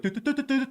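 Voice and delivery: high, light repeated "doo" noises; imitated laptop typing. Hazmat worker busy on a laptop, high imitated typing noise. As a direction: mimic busy key beeps in a high little voice.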